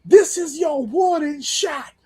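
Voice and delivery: high, slow line then loud shouted bow; mock promo with a shouted bow; imitating the warning shot. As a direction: ham up the cringe gunshot. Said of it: "he goes ... Like that".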